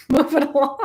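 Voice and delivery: high laughing repeat of the order; laughing mock order. Telling Cancers to move on, laughing. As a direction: shoo the crier along with a laugh.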